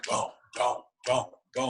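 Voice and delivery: high, breathy hammer noises; mock hammer noises; hammering sound. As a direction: imitate hammering. Imitating hammer blows.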